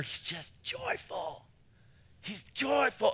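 whispered, high and slow; breathy whisper. Acted. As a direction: hush it in awe. Describing joyful actor.

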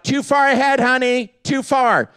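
imitated yelling. Wife calling to husband up ahead, imitated yell. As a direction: call ahead like a worried wife. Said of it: "She's yelling out".

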